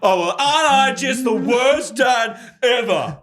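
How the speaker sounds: high, slow, laughing sarcasm; mock pity laughing. Friend mocking the dad's self-pity, laughing. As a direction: tease him through laughter.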